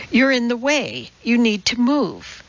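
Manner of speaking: ordinary delivery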